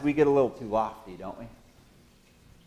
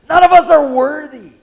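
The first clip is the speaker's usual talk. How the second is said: booming declaration; 11 semitones higher, 11 dB louder than usual. Acted. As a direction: proclaim it high and loud. Preacher's emphatic declaration.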